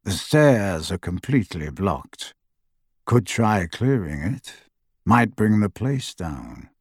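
low, soft, unbothered henchman; cool voice. A narrator's reading. Second Death Eater assessing the blocked stairs, cool low voice. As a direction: size it up coolly, low and soft. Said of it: "a cool second voice".

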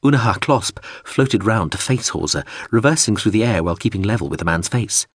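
ordinary delivery

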